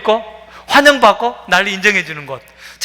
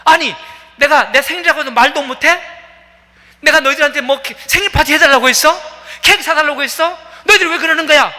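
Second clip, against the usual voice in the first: angry retort; 8 semitones higher, faster than usual. Retold or imitated. The birthday woman lashes back at the others, outraged yelling. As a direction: fire back, deeply hurt and furious. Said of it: "막 화를 내면서".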